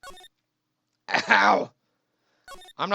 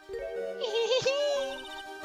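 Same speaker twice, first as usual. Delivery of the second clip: high light giggle; 13 semitones higher, 7 dB quieter than usual. Real, not acted. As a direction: giggle at him. Regina laughs at him.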